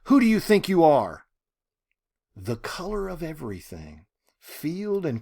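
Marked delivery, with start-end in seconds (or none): 0.0-1.2 loud, higher roar of fury. Acted. Ruby roaring at God in a final surge of fury. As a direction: roar it at the sky. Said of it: "she roared".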